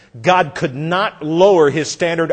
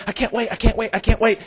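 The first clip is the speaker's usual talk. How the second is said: put-on higher, soft childlike chant; eager little girl; 6 semitones higher, 3 dB quieter, faster than usual. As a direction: bounce it like an impatient kid.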